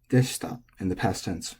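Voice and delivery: normal talking voice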